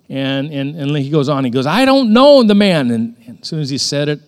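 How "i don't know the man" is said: high, loud, drawn-out denial; emphatic denial. Acted. Peter denies Jesus to bystanders, loud emphatic denial. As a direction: deny it loudly, stretching it out.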